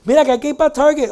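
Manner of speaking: wife pushing husband to Target, loud and fast; mock demanding wife. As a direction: rattle off the wife's demand, hammed up. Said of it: "wife voiced in the joke".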